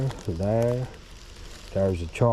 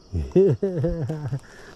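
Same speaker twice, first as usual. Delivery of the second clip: high cackling laugh; 9 semitones higher, 4 dB louder than usual. Real, not acted. Laughing at beaver.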